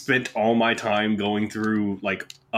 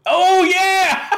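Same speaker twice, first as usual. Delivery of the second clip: high, loud "oh yeah" yell; hype yell; 8 dB louder than usual. Real, not acted. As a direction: whoop like a wrestling promo. Mock cheer for Scat.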